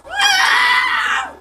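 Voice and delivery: sharp, very high loud cry of surprise; sudden high yelp; startled shout. Real, not acted. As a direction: yelp out in shock. Sudden game fright.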